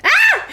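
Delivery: very high, loud short yelp; startled shriek. Real, not acted. Player startled by the potion pour, sudden shriek. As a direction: yelp sharply, then break into laughter.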